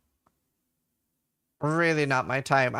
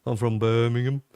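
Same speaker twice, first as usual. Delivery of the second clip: put-on deep Brummie accent; 5 semitones lower than usual. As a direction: lower Birmingham accent. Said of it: "everyone from Birmingham have a deeper voice".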